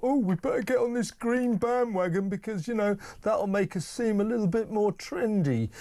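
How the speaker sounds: high, mocking posh politician; mock Tory voice. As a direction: sneer it like a smug Tory. Mocking Tories' inner thoughts.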